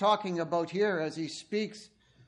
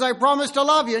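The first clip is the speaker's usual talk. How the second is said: louder, higher vow; groom's earnest vow; 7 semitones higher, 9 dB louder than usual. Acted. Groom vowing love to the bride, loud and earnest. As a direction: declare it to the whole church. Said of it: "and he says".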